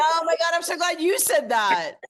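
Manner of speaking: real burst of delight, high and loud; excited outburst. Real, not acted. Host cutting in on guest's admission, excitedly.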